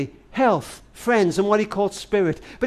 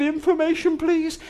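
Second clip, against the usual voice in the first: put-on higher, small child's voice asking the operator; a boy of nine, voice pitched up; little boy; 9 semitones higher, 3 dB quieter than usual. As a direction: play a nine-year-old phoning for help. Nine-year-old boy calls the operator.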